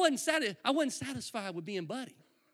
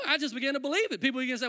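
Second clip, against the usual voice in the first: normal talking voice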